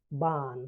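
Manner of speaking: বার্ন with a long vowel and no r; silent R. Shown as a demonstration. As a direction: drop the r in বার্ন. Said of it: "আর এর উচ্চারণ হবে না". (র বাদ দিয়ে বার্ন)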